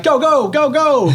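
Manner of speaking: put-on high-pitched excited little-kid chant; imitating young son. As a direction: cheer yourself on like a small child running. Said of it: "he's like".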